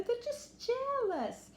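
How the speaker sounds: put-on mother brushing off jealous girls; mother scoffing. A narrator's reading. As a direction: wave off the bullies with a high scoff. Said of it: "said her mother".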